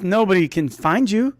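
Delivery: ordinary delivery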